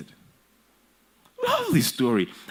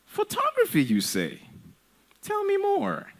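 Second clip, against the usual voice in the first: much higher, sing-song fake interest; mock fascination. Parent answering the son's photography dream a second time, mock-fascinated and high. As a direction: feign wide-eyed interest, high and sarcastic. Parent mocks son again.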